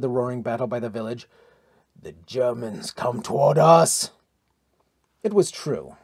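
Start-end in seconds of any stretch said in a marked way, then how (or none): 1.9-4.1 slow, low, drawn-out call; deep, stretched-out warning cry; warrior's warning shout. A narrator's reading. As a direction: call the warning across the ridge. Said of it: "shouted Heimdall".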